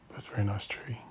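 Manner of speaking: soft unvoiced whisper; hushed aside at a bonsai show. Real, not acted. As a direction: murmur it so others nearby won't hear. Quiet remark at bonsai show.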